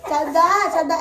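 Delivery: put-on चद्दा, the child's way of saying श्रद्धा; चद्दा said the way the little boy mangles the name; toddler's चद्दा for श्रद्धा; imitating a toddler. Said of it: "ऐसे बोलता है".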